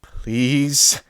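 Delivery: a desperate plea to the screaming mare; begging. Acted. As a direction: beg him to stop. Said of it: "I begged".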